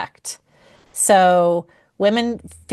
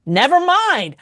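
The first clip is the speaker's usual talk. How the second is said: a much higher, sulky "never mind"; hurt husband; 9 semitones higher than usual. Retold or imitated. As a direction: give up mid-conversation in a wounded huff. Husband imitating hurt husband Greg.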